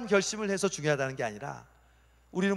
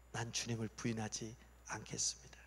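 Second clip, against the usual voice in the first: whispered, much softer vow; hushed vow; 6 semitones lower, 16 dB quieter than usual. Acted. Peter repeating his old vow to Jesus, whispered. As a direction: breathe the promise out in a whisper.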